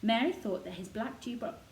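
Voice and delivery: ordinary delivery, nothing marked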